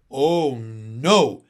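lightly voiced reproachful boy. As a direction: reproach her for getting it wrong. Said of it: "said Michael reproachfully".

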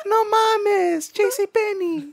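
high, excited Mexican mom voice; Mexican accent. Retold or imitated. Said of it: "a Mexican accent".

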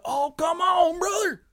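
very high, loud hammed-up southern drawl; fake southern accent. Mocking how southerners don't sound, high loud southern yell. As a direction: overdo a southern fan's cheer. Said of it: "They don't sound like".

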